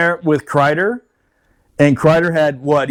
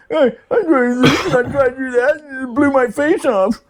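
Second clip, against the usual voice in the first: higher mock voice of a hurt soldier; Corporal Niederbach; 6 semitones higher than usual. Injured corporal explains the blast to the lieutenant, high mock voice. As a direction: pitch it up as the injured man explaining himself. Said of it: "see Corporal Niederbach over there going".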